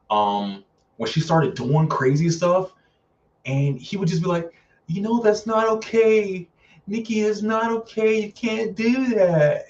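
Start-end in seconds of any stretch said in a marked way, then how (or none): 4.9-9.7 high, whiny imitation of pleading; drawn-out, high-pitched mock whine; mock whimpering. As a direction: whimper it like a spineless boyfriend. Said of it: "just whimpering and he was crying".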